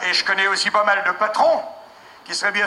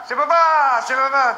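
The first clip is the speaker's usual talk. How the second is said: put-on high, loud child answer; toddler voice; 5 semitones higher, 4 dB louder than usual. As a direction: chant it like a toddler.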